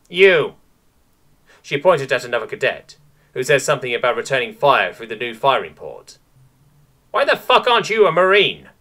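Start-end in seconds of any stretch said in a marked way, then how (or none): 0.1-0.6 high, loud pick of a cadet; single someone out sharply; commanding call. A narrator's reading. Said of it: "her mostly faux snooty nobility voice". 7.1-8.7 high, loud mocking captain; scornful shout. A narrator's reading. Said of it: "her mostly faux snooty nobility voice".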